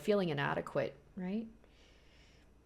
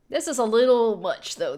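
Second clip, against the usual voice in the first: exclaiming; 4 semitones higher, 10 dB louder than usual. Real, not acted. Reacting to a creepy plan.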